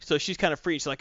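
ordinary talk, nothing marked

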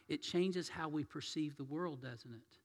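normal talking voice

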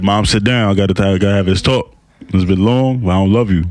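low-voiced, mock-grave sit-down speech; mock serious talk. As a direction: break bad news to a parent, pitched low. Acts out talk with mom.

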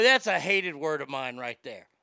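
ordinary talk

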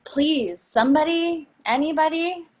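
pleading. Acted. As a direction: beg the whole car. Begging riders on the train.

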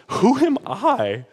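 slow, high-pitched imitation of weeping; hammed-up tearful cry; mock bawling. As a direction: wail it slowly, acting out a breakdown. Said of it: "I started bawling, and I was like".

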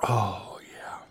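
refreshed sigh after drinking; savoring the drink, breathy; after a sip. Real, not acted. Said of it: "Let me take a sip of that".

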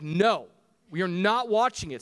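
loud, emphatic dad veto; dad's firm refusal. Retold or imitated. Dad refusing his kids their show, loud and firm. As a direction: put your foot down loudly. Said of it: "I'm like".

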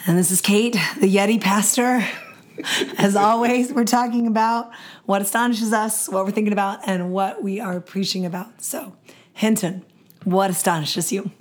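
hoarse, nearly lost voice; raspy whisper. Real, not acted. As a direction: speak through a raspy, worn-out throat. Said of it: "I'm astonished by your voice".

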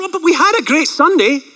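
high, naive excuse-making voice; mock protest. Mocks believer leaning on past Sunday.

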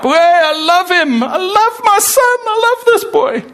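very high, delighted gushing; doting father. Acted. Acting out God as doting father.

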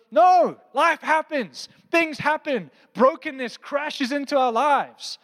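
preacher pounding the point home slowly; emphatic preaching. Real, not acted. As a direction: land each phrase slowly and hard.

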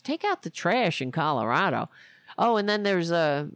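ordinary talk, nothing marked

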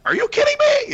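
squeaky, very high "are you kidding me"; mock disbelief. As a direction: jump way up in pitch with fake shock. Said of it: "a whole cool, like".

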